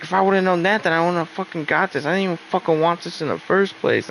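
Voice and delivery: imitating her mad outburst; mock furious rant. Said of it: "she got so mad and started shitting".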